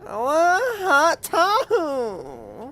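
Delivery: put-on high, slow, whiny kid voice with a laugh; whiny child voice. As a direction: stretch it out like a greedy kid. Whiny retake of line 69.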